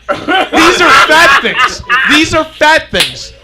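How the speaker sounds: loud, high, stretched-out cry with laughter; exasperated high comic shout, drawn out; comic outburst. Acted. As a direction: belt it out slowly as the rant's big climax. Climax of fat food rant.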